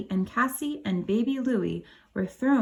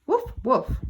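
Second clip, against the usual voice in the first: higher playful dog bark; puppy woof; 5 semitones higher than usual. Acted. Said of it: "said their big yellow puppy".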